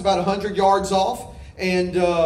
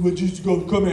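mock talking down to a puppy; patronizing. Preacher mock coaxing the dogs, patronizing. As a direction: coax like to a little pet. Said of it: "I started patronizing".

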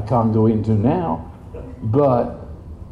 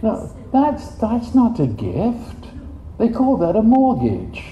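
incredulous; 8 semitones higher than usual. Real, not acted. Rejecting repayment idea.

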